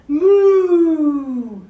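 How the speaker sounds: loud, long lowing moo; cow moo. Pretend. Song cow part.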